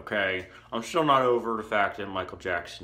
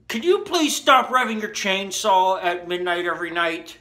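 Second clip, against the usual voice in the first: higher, pleading mock-neighbor voice; imitated neighbor complaint; 8 semitones higher, 3 dB louder than usual. Neighbor phoning to complain about the chainsaw, mock-pleading.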